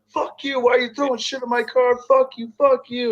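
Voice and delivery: high-pitched imitated screaming girl; mock shrill yell. Retelling the girl screaming at the group, high mock yell. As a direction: shriek it like the angry girl. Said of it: "fucking yelling at all of us".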